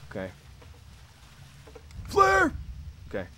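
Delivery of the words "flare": high barked soldier callout; Marine voice. Pretend. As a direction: bark it like a Marine. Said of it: "That's my Marine voice".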